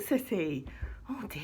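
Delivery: normal talking voice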